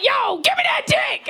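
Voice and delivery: high, slow shouted demand; hammed-up, over-aggressive come-on. Woman approaching a man far too forcefully, aggressive. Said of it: "most aggressive shit I could".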